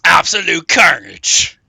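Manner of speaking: very loud, slow pronouncement; big drawn-out announcer-like call; emphatic exclamation. Real, not acted. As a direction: relish it loudly, stretching both words. Gleefully echoes race prediction.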